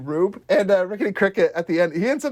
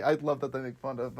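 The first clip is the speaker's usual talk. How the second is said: usual speaking voice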